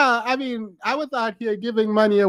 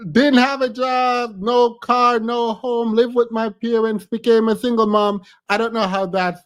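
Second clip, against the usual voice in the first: usual speaking voice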